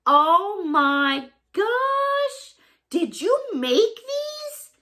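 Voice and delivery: high, very slow, stretched delight; thrilled. Real, not acted. Asking the sender, high and thrilled. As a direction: gush over a handmade gift. Amazed at handmade earrings.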